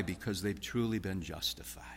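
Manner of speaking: hushed, voiceless whisper. Real, not acted. Preacher confiding the reason to his flock in a whisper. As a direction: lean in and breathe it to the congregation.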